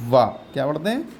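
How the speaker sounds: ordinary talk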